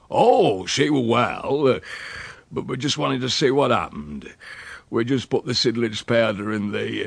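broad country dialect with hesitant breaks; rustic old uncle, evasive. Acted. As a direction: dodge the question, then turn hospitable.

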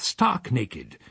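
ordinary delivery

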